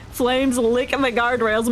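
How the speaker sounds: ordinary talk, nothing marked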